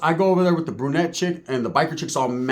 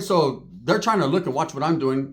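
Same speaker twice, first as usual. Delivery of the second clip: ordinary talk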